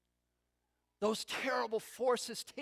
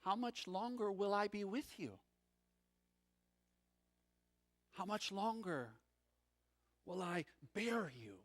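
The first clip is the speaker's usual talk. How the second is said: softer, weary "How much longer will I be with you?"; weary sorrow; 5 dB quieter than usual. Retold or imitated. Jesus' sorrowful question.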